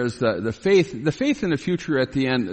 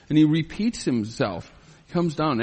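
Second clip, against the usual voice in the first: ordinary talk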